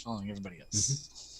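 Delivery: ordinary talk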